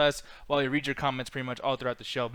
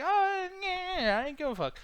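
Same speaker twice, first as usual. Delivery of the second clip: mock indifference; 12 semitones higher, slower than usual. Retells own indifferent reply.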